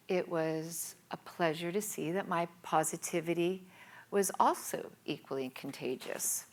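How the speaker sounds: usual speaking voice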